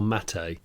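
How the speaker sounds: "Mate" said as MAT-ay; third name version. Shown as a demonstration. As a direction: say "Mate" in two syllables, short a then tay. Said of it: "three versions of his name already".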